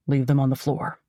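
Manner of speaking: low, commanding man's voice; a stranger barking orders low; deep male voice. Acted. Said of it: "I don't recognize the deep male voice".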